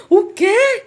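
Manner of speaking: high, loud cry of disbelief; retold shout. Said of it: "comecei logo a gritar, chorando". (grito alto e agudo de espanto)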